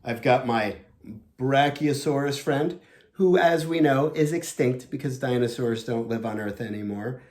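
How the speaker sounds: usual speaking voice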